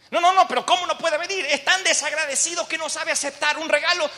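high, loud-edged outraged objection; indignant protest. Acted. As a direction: object in disbelief, pitch way up. King protests indignantly at refusal. (protesta indignada en tono muy agudo)

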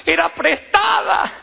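high, loud cry of dismay; desperate shout. Acted. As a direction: yell it out, the borrowed axe is lost. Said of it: "Y grita el obrero".

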